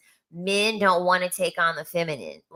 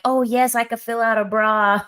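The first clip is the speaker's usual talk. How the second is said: higher, louder gushing mock voice; mock effeminate man; 5 semitones higher, 4 dB louder than usual. Mocking men who'd fill out bras, high gushing delight. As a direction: gush it like a man thrilled with himself.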